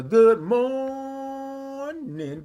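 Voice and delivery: very long high-held MORNING; signature good morning. Pretend. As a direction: sing out a slow, stretched greeting. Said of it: "that's how I say it".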